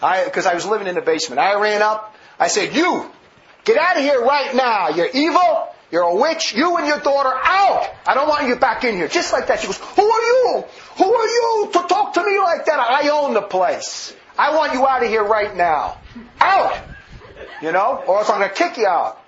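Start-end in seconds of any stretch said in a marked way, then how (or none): 2.8-9.1 telling the intruders to leave, raised voice; angry order. Retold or imitated. 9.8-19.2 the woman shouting back at the owner, mocked; mock angry woman. Woman yells back at him.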